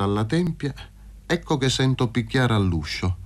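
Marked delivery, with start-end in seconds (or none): none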